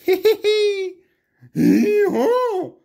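very high, loud braying hee hee laugh; donkey laughs at the girl, very high and loud; donkey's laugh. A narrator's reading. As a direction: squeal the donkey's cackle up high. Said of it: "cried the donkey".

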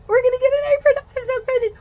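squealing excitement. Real, not acted. Telling viewers about the free apron, squealing with excitement. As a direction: squeal with delight over the apron.

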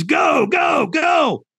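high-pitched, drawn-out shouts; shouted urging. Retold or imitated. Trainer sending a firewalker onto the coals, high urgent shouting. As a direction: drive them forward with each shouted word.

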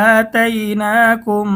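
আতাইনাকুম slowly, the ম nasalised; slow recitation with ghunna. Shown as a demonstration. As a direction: draw out আতাইনাকুম in chant. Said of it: "মিম সাকিনের ইকফা গুন্না সহ পড়তে হবে". (গুন্না সহ ধীরে তিলাওয়াত)

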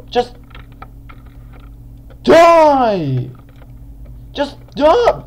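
high, loud, exasperated yell; aggressive gamer yelling. Real, not acted. Gamer trying to finish off an opponent, aggressive high yell. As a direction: shriek it at a stubborn enemy. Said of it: "sometimes a bit aggressive in games".

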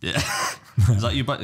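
ordinary delivery

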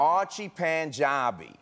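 slow, stretched New Orleans drawl; New Orleans accent. Shown as a demonstration. Host says the actress's name on request, New Orleans accent. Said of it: "say my name with the New Orleans".

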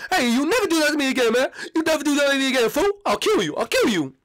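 high, hammed-up threatening rant; angry [name]. [name] warning his friend never again, mock fury. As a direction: rant shrilly at the prankster.

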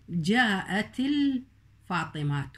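الفاطمات drawn out slowly, final t clearly sounded; slow careful demo. Shown as a demonstration. Teacher slowly showing the t of الفاطمات to the pupils. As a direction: stretch out الفاطمات and land on its t. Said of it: "والوقف عليها الفاطمات لفظت".

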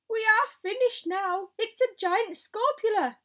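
high, loud wail of doom; panicked yell. A narrator's reading. Fire chief despairing at the giant spider, panicked yell. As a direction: cry out that all is lost. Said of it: "yelled the head of the fire department".

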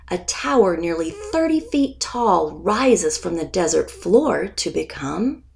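ordinary delivery

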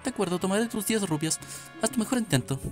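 high, bright guard voice reading game text; cute anime streamer. Acted. Reads Gerudo guard lines. (voz aguda y tierna de guardiana)

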